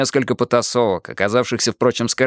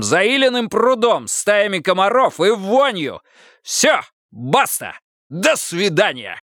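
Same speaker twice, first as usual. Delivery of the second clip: furious tirade; 7 semitones higher than usual. A narrator's reading. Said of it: "закричал так".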